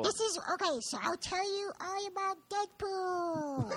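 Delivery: high, slow tiny voice; squeaky little cat talking. Shown as a demonstration. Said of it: "my cat sounds like this".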